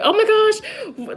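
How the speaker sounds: high, drawn-out mock yell without the volume; mock startled yell. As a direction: imitate a defensive outburst, held back in volume. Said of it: "I start yelling".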